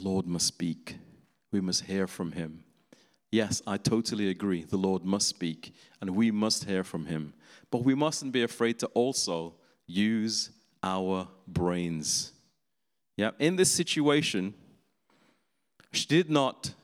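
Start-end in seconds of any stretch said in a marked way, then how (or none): none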